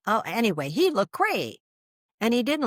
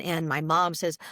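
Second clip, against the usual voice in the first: normal talking voice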